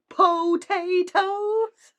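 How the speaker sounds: very loud, drawn-out shout; yelled punchline. Pretend. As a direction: belt the punchline slowly.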